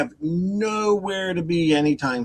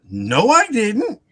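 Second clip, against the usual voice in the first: drawn-out, higher, louder denial; retold denial; 6 semitones higher, 5 dB louder, slower than usual. Driver answering the dog owner, indignant denial. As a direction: deny it slowly and emphatically.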